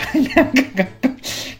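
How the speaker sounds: burst of laughter. Real, not acted. Laughs after the impression.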